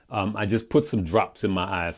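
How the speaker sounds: ordinary delivery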